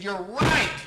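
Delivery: loud, high retort; emphatic agreement. Retold or imitated. Therapist answering client's accusation, loud emphatic agreement. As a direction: agree at full volume, as a comeback.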